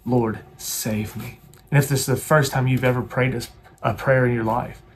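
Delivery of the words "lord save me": slow plea. Shown as a demonstration. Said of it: "to just cry out".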